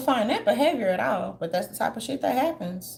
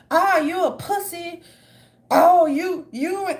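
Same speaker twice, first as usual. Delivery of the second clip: mock taunt; 4 semitones higher, 5 dB louder, slower than usual. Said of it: "really truly expressing themselves".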